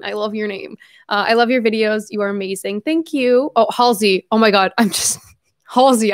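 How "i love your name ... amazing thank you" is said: normal talking voice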